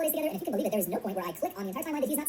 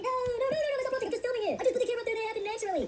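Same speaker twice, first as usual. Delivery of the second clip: high-pitched whiny denial; mock defensive protest; 12 semitones higher than usual. Host mocking creators who deny prompting, high defensive protest. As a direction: protest innocence in a squeaky high voice.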